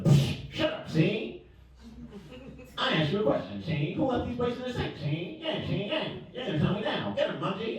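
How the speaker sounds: high, mocking African-accented interrogation; bully him like a big brother; older brother's African accent. Brother grilling him about the dish in a mocking African accent.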